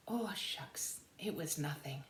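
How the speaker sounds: soft bashful shrug-off; modest whisper. Acted. Stubby bashfully shrugs off the soldiers' praise, soft. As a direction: play it down shyly.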